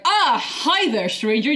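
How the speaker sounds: high, drawn-out hello; hammed-up friendly enthusiasm; imitated eager greeting. Imitating Bashir greeting Data in the lab, over-eager.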